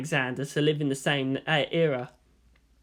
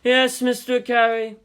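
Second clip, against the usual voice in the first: lecturing teacher; 7 semitones higher, 4 dB louder than usual. Acted. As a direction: list them like a lecture.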